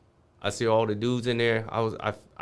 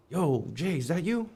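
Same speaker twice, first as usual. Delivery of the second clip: higher calling voice, surprised; retold friend's call; 7 semitones higher than usual. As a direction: call across the cells to an old friend. Old friend calls from cell.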